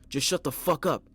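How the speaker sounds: high, loud angry yell; furious shout. A narrator's reading. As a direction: brother yelling back at the killer. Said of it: "Andy cried out at him".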